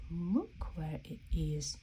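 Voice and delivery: hushed reveal whisper; soft surprised whisper; secret whisper. Acted. Playful reveal of hidden hat.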